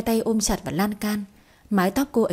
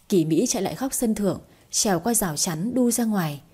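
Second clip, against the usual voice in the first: ordinary delivery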